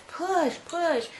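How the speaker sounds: high-pitched imitation of a doctor urging; retold coaching. Doctor's command to push, retold.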